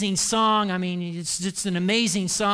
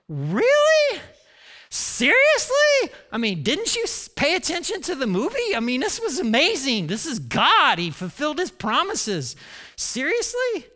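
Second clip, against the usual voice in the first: squeaky-high mock disbelief; incredulous; 8 semitones higher, 3 dB louder than usual. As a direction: pitch shoots way up on the questions. Acted disbelief at the grumbling Israelites.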